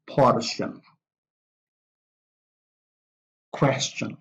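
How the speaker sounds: CAP-tion, MIS-sion, NA-tion, POR-tion, QUES-tion, each stressed at the front; first-syllable stress. Shown as a demonstration. Teacher models two-syllable nouns, first-syllable stress on caption, mission, nation, portion, question. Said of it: "the stress is on the first syllable".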